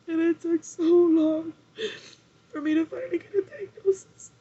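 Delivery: fighting back real tears; crying. Real, not acted. Telling viewers about her diagnosis, crying.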